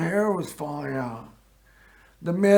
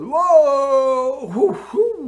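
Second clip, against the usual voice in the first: high, loud startled whoops from a nurse; shrill-pitched alarmed whoa whoo whoo; 9 semitones higher, 5 dB louder, much slower than usual. Retold or imitated. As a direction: whoop it out like a startled nurse catching a strong smell. Nurse smells oil, startled.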